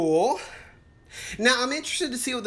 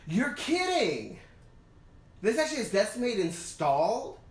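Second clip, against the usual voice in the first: surprised disbelief; 5 semitones higher than usual. Real, not acted. Surprise at a program still installed.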